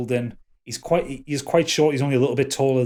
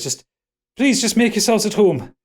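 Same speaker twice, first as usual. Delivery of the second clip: higher, louder, placating elf; harried, raised voice making excuses; flustered host; 9 semitones higher, 6 dB louder than usual. Acted. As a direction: politely stall them, voice pitched up. Elf host settles guests.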